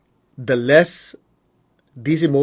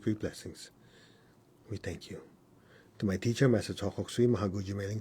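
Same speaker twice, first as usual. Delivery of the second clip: softer, reverent thanksgiving prayer; prayer of thanks; 3 semitones lower, 8 dB quieter than usual. Acted. Teacher thanks the masters after meditation, soft prayer. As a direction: give thanks quietly and devoutly.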